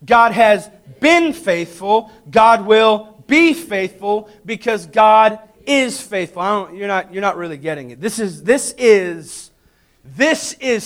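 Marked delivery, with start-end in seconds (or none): 0.0-6.4 deliberate, slow, every word weighed; slow declaration. Shown as a demonstration. Preacher leads the church in a slow declaration. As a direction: spell it out slowly for the congregation. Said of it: "Would you say that with me?".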